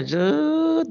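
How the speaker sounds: very high imitated "zet"; quick high sound for opening notes; whoosh sound effect. (bunyi tiruan "zet", nada tinggi)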